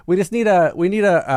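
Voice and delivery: usual speaking voice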